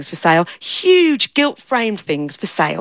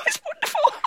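laughing high with glee; delighted laugh; 9 dB quieter than usual. Acted. Daft fella thrilled to find his shirt, laughing. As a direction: giggle it out in pure delight.

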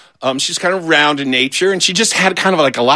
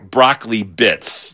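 ordinary delivery

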